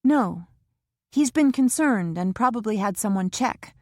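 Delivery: Kara anxious in the waiting room; young Kara voiced. A narrator's reading. Kara's voice in the novel.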